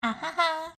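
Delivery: ordinary delivery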